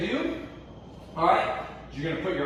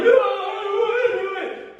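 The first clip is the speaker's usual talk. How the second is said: whining complaint, much higher and louder; whiny kid; 5 dB louder than usual. Pretend. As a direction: whine like a kid who won't do squats. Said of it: "all the bobs are like".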